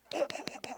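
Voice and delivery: small dry cough; little cough. Acted. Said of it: "he gave a little cough".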